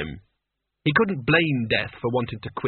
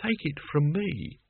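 put-on weary old Albert; 3 semitones higher, 5 dB quieter than usual. A narrator's reading. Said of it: "He sighed".

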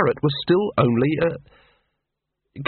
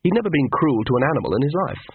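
ordinary delivery, nothing marked

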